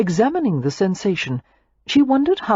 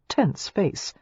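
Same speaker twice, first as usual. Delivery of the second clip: ordinary delivery, nothing marked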